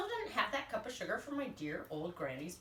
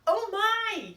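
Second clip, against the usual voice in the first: higher, louder mock shock; 11 semitones higher, 9 dB louder than usual. Wolf reacting to the granny insult, loud and high. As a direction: exclaim in hammed-up horror.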